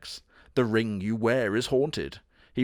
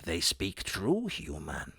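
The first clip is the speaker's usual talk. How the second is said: low, slow, soft cat spirit; a hushed, solemn cat; slow, deep mock-mystic cat; ghost cat voice; 5 semitones lower, 8 dB quieter, slower than usual. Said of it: "Mister Kitty is like".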